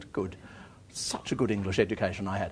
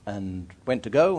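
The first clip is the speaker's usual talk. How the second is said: normal talking voice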